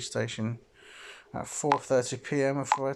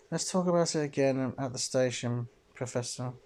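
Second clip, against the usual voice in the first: normal talking voice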